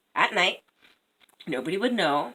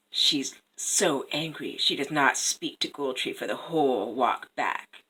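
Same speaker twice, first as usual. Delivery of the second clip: ordinary delivery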